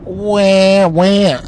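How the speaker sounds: high, stretched-out "wah"; cartoonish complaining whine; mock Republican whine. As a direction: wail it in ridicule. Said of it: "The Republicans are saying".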